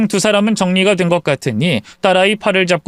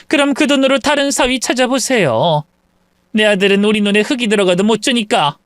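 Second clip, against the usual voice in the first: lightly voiced scornful mother-in-law; mocking in-law; 6 semitones higher than usual. In-law mocks the mother's wealth, scornful. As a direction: sneer at her money.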